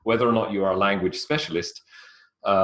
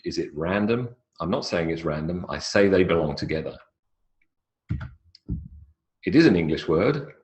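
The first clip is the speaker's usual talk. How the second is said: ordinary delivery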